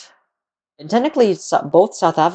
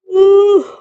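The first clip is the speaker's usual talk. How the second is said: loud yawn; 9 dB louder than usual. Real, not acted. Yawn after saying so.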